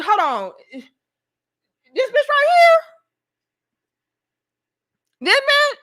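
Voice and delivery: high, loud disbelieving questions; mock incredulous. [name] answering the accusation, mock incredulous. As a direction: imitate someone in indignant disbelief, pitch shooting up.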